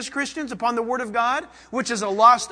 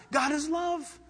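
usual speaking voice

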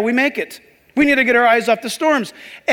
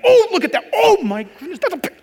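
much higher, awed exclamation; impressed; 8 semitones higher than usual. Retold or imitated. Preacher acts himself gawking at a storm, awed. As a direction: gawk at the storm in wonder. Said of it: "I'll get impressed".

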